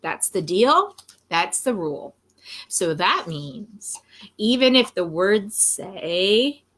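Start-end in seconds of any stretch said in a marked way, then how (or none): none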